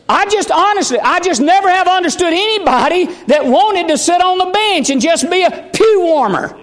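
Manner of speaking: high-pitched, emphatic pulpit delivery; forceful preaching. Real, not acted.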